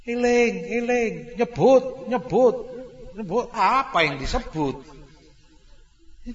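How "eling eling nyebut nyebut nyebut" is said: high pressing repeated urging; mock of anxious relatives; urgent calling. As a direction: nag the dying to remember God. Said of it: "ditambahi dengan kalimat-kalimat lain".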